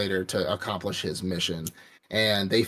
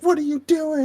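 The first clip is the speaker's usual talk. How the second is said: very high shrill woman's shriek; high indignant woman's voice; mother shrieking; 4 dB louder than usual. Retold or imitated. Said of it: "She's like".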